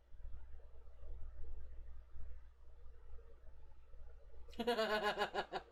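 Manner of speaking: silent, wheezing laughter; helpless laugh with barely any voice; long breathless laugh. Real, not acted. Streamer cracks up at a chat joke, wheezing laughter.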